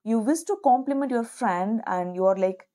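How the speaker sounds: usual speaking voice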